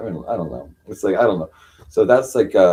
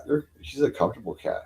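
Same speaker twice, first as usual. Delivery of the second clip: normal talking voice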